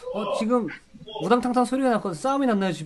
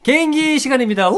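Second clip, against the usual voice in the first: loud, high hype announcement; 8 semitones higher, 7 dB louder than usual. Real, not acted. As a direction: announce it like a show segment. Announcing talent segment.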